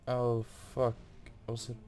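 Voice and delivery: ordinary talk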